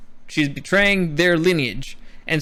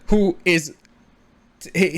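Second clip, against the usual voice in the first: usual speaking voice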